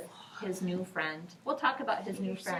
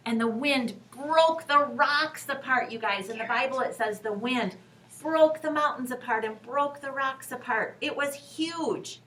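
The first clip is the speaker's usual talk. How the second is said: louder, higher dramatic storytelling; dramatic loud telling; 4 semitones higher, 6 dB louder than usual. A narrator's reading. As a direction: make the wind sound mighty.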